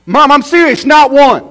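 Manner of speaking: put-on boy yelling joyfully at the top of his voice; excited shout. Boy shouting to his mom off the bus, thrilled.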